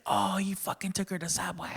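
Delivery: excited audience member butting in, retold; heckler's outburst. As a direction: imitate a lady blurting from the crowd. Heckler blurting out the punchline.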